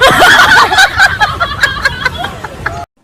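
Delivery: big laugh from the crowd; audience laughter. Real, not acted. Audience laughing at the exam joke.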